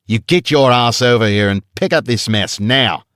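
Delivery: guard ordering the woman to fetch the stone; barked order. A narrator's reading. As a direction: order her over in an Australian accent.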